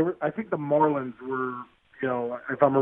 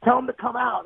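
fans yelling; 9 semitones higher than usual. Retold or imitated. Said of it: "these guys are like".

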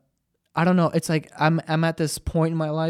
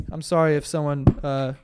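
ordinary delivery

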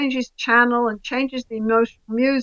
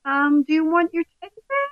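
put-on higher, gentle voice soothing a scared child; soft motherly voice; 6 semitones higher than usual. Mother comforting her crying child, soft and high. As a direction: coo softly to a crying baby. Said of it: "softens her voice".

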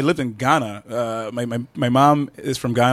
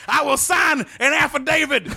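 high, shouted ringside commentary; wrestling commentator; 11 semitones higher than usual. Pretend. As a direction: call the carnage like it's the end of the world. Wrestling commentary bit, both speakers.

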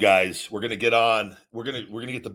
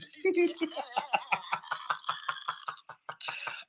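high laugh; 12 semitones higher, 8 dB quieter than usual. Real, not acted. After Cena bit.

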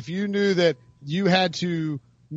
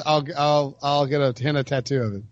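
ordinary delivery

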